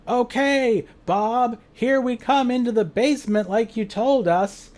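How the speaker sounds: high, loud, stagey announcement; awkward yell. Acted. Bad liar warning Bob in the basement, awkward yell. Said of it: "very awkwardly yells".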